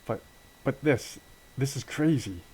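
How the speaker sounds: rising nervous protest; nervous disbelief. Acted. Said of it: "And Andy's like".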